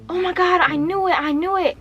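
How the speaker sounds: high-pitched retold exclamation; worked-up dismay. Acted frantic phone call reply.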